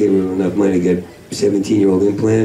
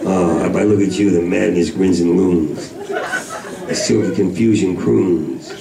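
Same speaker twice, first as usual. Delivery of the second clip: poetry recital; 5 semitones lower than usual. Acted. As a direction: intone it like a moody poem. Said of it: "Can you talk like that?".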